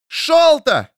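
desperate high call across water; shouting a name. Acted. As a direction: yell the name out loud. Calling out again.

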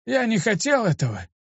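regretful apology in a high character voice; sad. A narrator's reading. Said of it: "грустно ответил мне старик". (грустно, виновато)

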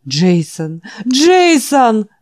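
high loud sobbing cry. Acted. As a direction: wail the name through tears. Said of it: "рыдал старик". (рыдая)

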